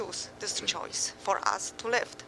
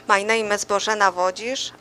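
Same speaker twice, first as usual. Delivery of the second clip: louder, with a small laugh; to someone hard of hearing; 8 dB louder than usual. Real, not acted. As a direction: speak up so the room hears. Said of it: "Can you speak up".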